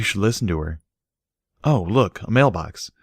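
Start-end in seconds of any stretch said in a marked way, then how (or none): none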